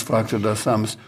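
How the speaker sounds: ordinary delivery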